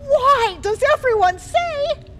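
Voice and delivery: high, loud mocking repeat; mocking echo. Queen mocks the girl's question. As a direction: throw her words back at her.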